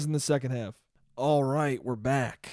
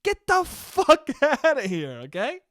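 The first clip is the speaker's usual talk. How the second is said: laughing dismissal; 8 semitones higher than usual. Acted. Laughing dismissal of imagined newcomers.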